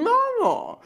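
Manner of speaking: high, slow mock-expert voice; snobby music expert. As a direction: imitate a know-it-all musician, drawn out. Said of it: "die sagen".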